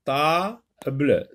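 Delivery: "table" as a held "ta", then "ble"; teacher breaking "table" into two syllables; split into syllables. Shown as a demonstration. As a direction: pause inside "table" before the "ble". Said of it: "Dans ta, oui, on entend".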